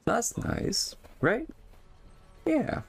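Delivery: very high mock squeak; squeaky high voice. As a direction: jump way up into a squeaky voice. Jokey echo of earlier remark.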